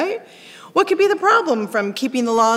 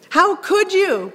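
exasperated; 8 semitones higher, slower than usual. Acted. Preacher voicing exasperated Paul.

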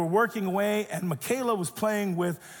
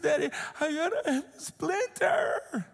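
put-on higher, slow, tearful child's whine; imitated crying child; 9 semitones higher, slower than usual. Daughter crying to her dad about the splinter, tearful whine. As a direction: whimper it like a hurt little girl. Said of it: "she was weeping and weeping and wailing".

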